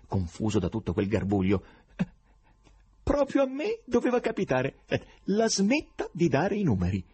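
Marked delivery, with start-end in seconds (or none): 2.0-7.0 high, odd foreign professor voice, exasperated; eccentric professor. Acted. Foreign professor grumbling at the writers, high odd voice. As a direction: complain like a strange foreign scholar.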